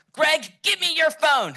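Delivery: very high, loud, drawn-out shout; hammy high-pitched guru impression; Richard Simmons. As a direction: holler the demand way up high. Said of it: "I kinda do a decent impression".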